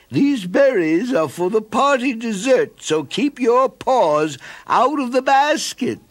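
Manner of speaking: high, slow warning mother's voice; stern mother. A narrator's reading. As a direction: remind the child firmly. Mother warns her son.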